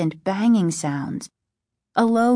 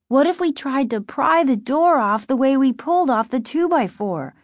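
put-on higher, urgent girl suggesting a plan; lightly voiced eager girl; 6 semitones higher than usual. Narrator voices the girl Margaret.

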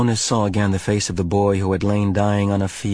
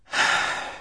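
sigh; 3 dB quieter than usual. A narrator's reading. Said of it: "Jonas sighed".

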